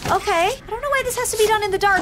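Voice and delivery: ordinary delivery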